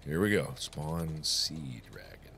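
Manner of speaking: soft slow whispered command. Real, not acted. Gamer spawning a sea dragon, slow whisper. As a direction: whisper it slowly like a secret.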